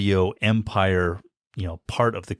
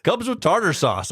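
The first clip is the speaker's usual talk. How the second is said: high commercial announcer tag line; hammed-up ad read over laughter; Burger King ad; 11 semitones higher than usual. Shown as a demonstration. Finishing the mock burger commercial, announcer voice. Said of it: "I do Burger King ads for the Bahamas".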